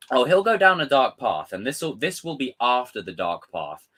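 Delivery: ordinary delivery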